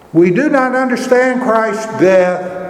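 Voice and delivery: ordinary talk, nothing marked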